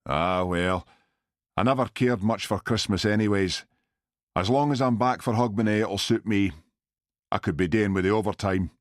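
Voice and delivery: put-on brisk Glasgow accent. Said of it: "in his heavy Glasgow accent".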